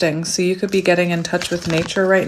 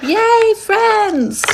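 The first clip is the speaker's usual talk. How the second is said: high, giddy celebratory squeal; elated high-pitched yay; gleeful cheer; 14 semitones higher, 4 dB louder than usual. Real, not acted. Cheers at drawing friendship card.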